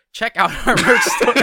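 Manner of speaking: loud, high fake enthusiasm; mock hype. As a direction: ham up the plug. Sarcastic script reading.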